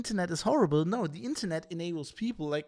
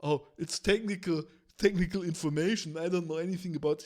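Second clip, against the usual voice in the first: airy dismissive voice, rushing the last line; mocking clueless reporter. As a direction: brush it off like you can't be bothered. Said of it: "Because you go like".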